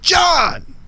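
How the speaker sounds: loud, high shout of a name; screamed out. Acted. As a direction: yell it out to a whole crowd. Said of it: "scream out ... loud as I can".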